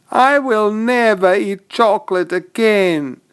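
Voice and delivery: put-on high little-boy vow; Joey exclaiming. Joey swearing off chocolate after the feast, high and earnest. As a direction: declare it in a high child's voice. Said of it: "Joey exclaimed".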